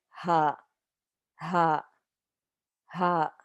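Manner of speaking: "ha" said with a light H. Shown as a demonstration. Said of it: "Ha is a lighter H sound".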